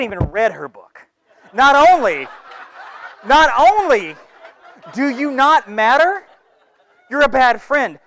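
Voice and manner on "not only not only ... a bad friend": laughing taunt. Acted.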